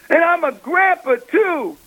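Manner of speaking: high-pitched retold emotional outburst; moved man, imitated in a high voice; imitated tearing up. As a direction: quote him high, as if moved to tears. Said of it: "he's, like, tearing up".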